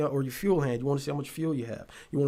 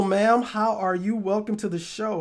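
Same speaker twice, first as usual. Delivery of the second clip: ordinary talk, nothing marked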